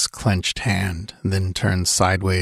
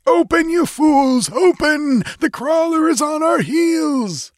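high, frantic scream at the guards. A narrator's reading. Tekodl screams at the guards as the Crawler nears. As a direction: scream it, terrified, pounding the door.

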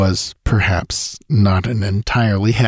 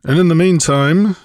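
loud, higher-pitched interjection; breaking in; 8 semitones higher, 5 dB louder than usual. A narrator's reading. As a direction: cut in forcefully on the discussion. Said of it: "broke in Malric".

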